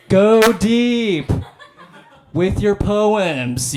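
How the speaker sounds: long, drawn-out high holler; loud, slow sports-dad yell; dad calling out. Acted. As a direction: call it downfield to your kid, stretching each word. Dad calling out during pretend catch.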